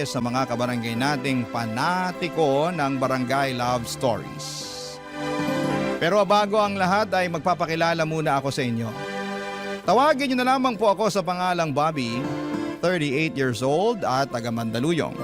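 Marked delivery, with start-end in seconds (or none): none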